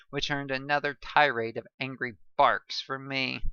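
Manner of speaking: plain reading voice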